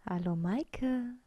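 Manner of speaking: slow, drawn-out creature greeting; talking bread. A narrator's reading. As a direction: stretch it out like bread calling someone. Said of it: "hörte ich das Brot reden". (sprechendes Brot, langsam und gedehnt)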